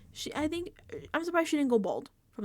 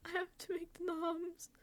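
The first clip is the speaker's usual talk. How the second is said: small, higher, softer whimper; timid whine; 7 semitones higher, 7 dB quieter than usual. Retold or imitated. Lauren dreading her nominations, in a small higher whimper. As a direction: squeak it out like a scared kid. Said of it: "She, she is like, like, um".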